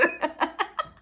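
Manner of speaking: high squealing laughter; giggling fits at herself; laughing. Real, not acted. Laughs at own princess demo.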